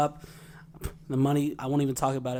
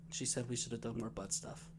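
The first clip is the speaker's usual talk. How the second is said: dead serious and much softer; deadpan; 4 semitones lower, 16 dB quieter than usual. Retold or imitated. Father telling his grieving kids the last words, deadpan. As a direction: say it straight-faced and quiet. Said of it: "completely serious".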